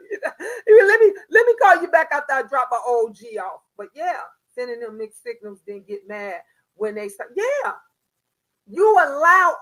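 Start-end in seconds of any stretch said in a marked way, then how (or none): 0.1-3.6 loud, laughing retelling; laughing through it. Real, not acted. Mother laughing while quoting her son on the phone.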